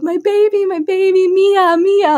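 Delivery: put-on high calling for her baby. To her newborn across the room, high tearful calling. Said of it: "just yelling for her".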